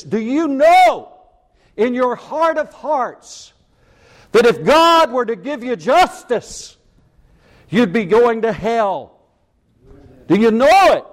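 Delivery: fiery preacher demanding an answer; preaching at full force. Acted. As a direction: drive the question home to the congregation. Preaching climax, direct challenge.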